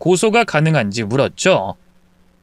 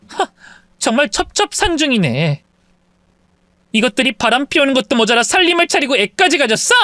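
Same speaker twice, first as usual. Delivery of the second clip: exasperated, near-tearful wife; disgusted wife; 9 semitones higher than usual. Acted. Wife learning mistress is pregnant, exasperated. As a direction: vent the disgust at him.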